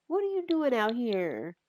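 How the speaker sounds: high, swooping question; cooing baby talk. Acted. Grandma finding the baby outside, high coo. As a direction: start high and glide down, as to a baby.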